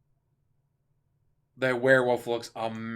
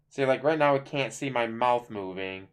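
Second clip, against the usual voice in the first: ordinary talk, nothing marked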